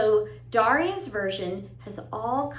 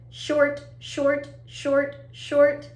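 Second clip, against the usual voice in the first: staccato "short", each one cut brief; short sound; 2 semitones higher, much slower than usual. Shown as a demonstration. Teacher modelling short sounds for children, brief and staccato. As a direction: say each "short" as a quick separate beat. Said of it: "the sounds sound long and short too".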